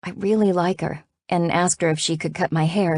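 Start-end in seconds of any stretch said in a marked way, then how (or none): none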